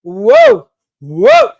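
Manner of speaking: big, high, shouted whoa; loud stunned whoa. Real, not acted. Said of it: "reacting loudly to the story".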